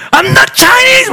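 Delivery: very high, loud, drawn-out shout; imagined angry retort. Retold or imitated. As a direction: yell it back in exaggerated indignation. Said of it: "막 하고 싶은 거예요".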